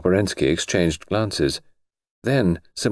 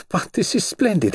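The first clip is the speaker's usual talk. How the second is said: cartoon princess; 12 semitones higher than usual. Grand Duchess delighted.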